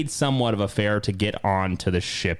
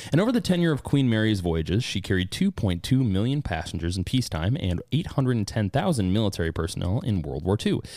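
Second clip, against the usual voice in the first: normal talking voice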